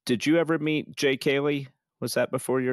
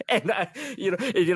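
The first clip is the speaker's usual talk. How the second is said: laughing; 8 semitones higher than usual. Real, not acted. Nervous laughter recalled.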